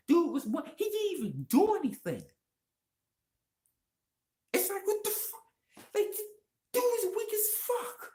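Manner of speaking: outraged squeal of a rant; ranting high and incredulous about a weak villain; exasperated rant. Real, not acted.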